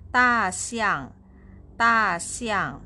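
two falling tones on "Da xiang"; both fourth tone. Shown as a demonstration. Teacher showing learners the word for elephant, both syllables falling. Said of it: "Fourth tone, both".

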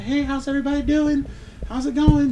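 high, sweet hostess greeting; a lightly voiced, overly nice mom welcoming guests. Mom greeting party guests, in a high sweet voice. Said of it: "it's just nice as shit".

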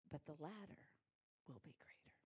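low, hushed, fading to a breath; near whisper. Acted. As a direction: drop to almost nothing, intimate. God's answer, spoken low.

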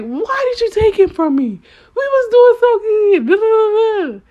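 high, whiny mock crying complaint; mock whining cry. To God about what He took, a high mock wail. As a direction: wail it like a sulking child. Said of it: "kicking and screaming like".

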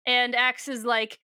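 normal talking voice

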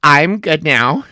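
ordinary delivery

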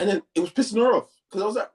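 usual speaking voice

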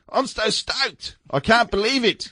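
high, slow mock Kiwi accent quoting the lamb owner.

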